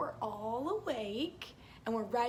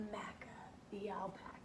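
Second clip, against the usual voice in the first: whisper; 4 semitones lower, 14 dB quieter, faster than usual. Acted. Reading aloud.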